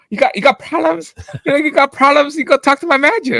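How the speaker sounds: high-pitched retold challenge; quick, worked-up brush-off of a customer; confrontational dismissal.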